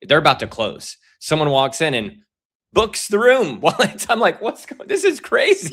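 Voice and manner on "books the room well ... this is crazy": much higher, laughing disbelief; can't believe it, laughing; amazed laughter. Real, not acted. Excited laughing retelling.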